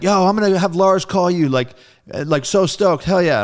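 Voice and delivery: high and loud, buzzing with excitement; excited bandmate. Retold or imitated. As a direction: hype him up on the phone.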